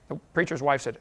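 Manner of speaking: ordinary talk, nothing marked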